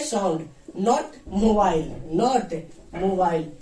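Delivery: not mobile said slowly with a firm fall on not; slow, firm not on sound, not mobile; stress on not. Shown as a demonstration. Teacher to class, slow stress on not. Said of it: "ये phone का मतलब यहाँ पे mobile नहीं चलेगा".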